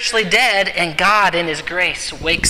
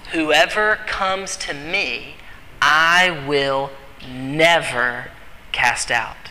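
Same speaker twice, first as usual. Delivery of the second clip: slow, spaced-out verse, weight on never; deliberate, measured scripture repeat; slow emphatic repetition; 3 semitones lower, much slower than usual. Shown as a demonstration. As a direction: linger on "never", then drop low on "cast out".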